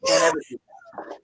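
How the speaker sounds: EVER hit high and stretched; don't EVER do that, pleading; emphatic warning. Real, not acted. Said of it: "urgent warning, high and emphatic".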